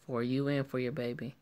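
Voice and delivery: ordinary delivery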